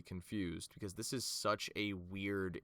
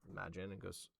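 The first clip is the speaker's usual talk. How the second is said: ordinary talk, nothing marked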